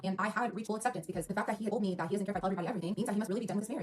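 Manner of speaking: usual speaking voice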